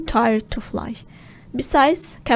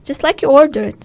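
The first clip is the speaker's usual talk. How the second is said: eager answer; 3 semitones higher, 7 dB louder than usual. Acted. Smee answering Hook.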